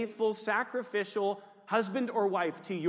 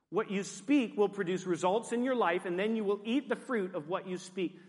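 usual speaking voice

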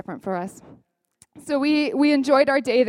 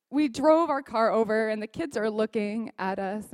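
ordinary talk

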